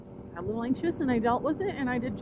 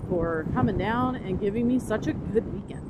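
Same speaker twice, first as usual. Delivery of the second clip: usual speaking voice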